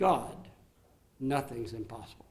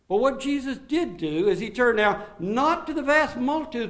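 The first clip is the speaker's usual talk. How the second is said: loud, high preaching, driving each point home; full-force preaching; 7 semitones higher, 7 dB louder than usual. Acted. Pastor preaching to the congregation on Jesus' words, full force.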